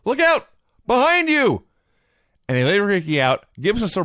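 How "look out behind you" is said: high, loud warning shout; mock ringside scream. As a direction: yell a warning to the wrestler. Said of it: "Crockett is screaming".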